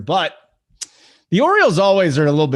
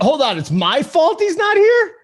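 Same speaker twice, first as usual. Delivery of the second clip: indignant disbelief; 13 semitones higher than usual. Real, not acted. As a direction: protest the blame in a much higher pitch. Indignant reply to being blamed.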